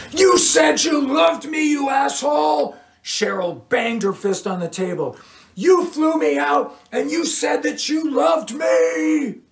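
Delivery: high screaming rage; scorned woman screaming at her ex in a bar; furious shout. A narrator's reading. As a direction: shriek it at him across the table. Cheryl yells, bangs table.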